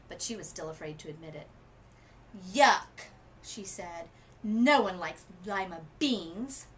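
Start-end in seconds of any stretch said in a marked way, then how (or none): 2.5-6.7 disgusted. A narrator's reading. Camilla denying she likes beans.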